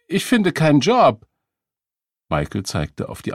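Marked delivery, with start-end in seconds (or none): 0.1-1.3 high, loud excuse; defensive protest. A narrator's reading. Beggar asked why he doesn't work, defensive protest. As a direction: protest in a raised, higher voice.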